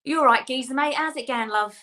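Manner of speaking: quick, matey East London Cockney; native-sounding Cockney demo; Cockney accent. Shown as a demonstration. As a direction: greet a mate in broad Cockney. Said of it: "do a little Cockney for me".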